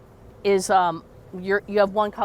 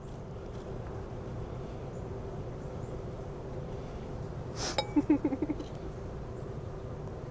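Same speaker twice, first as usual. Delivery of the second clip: breathy laugh; 7 semitones higher, 14 dB quieter than usual. Real, not acted. Long laugh during sand pour.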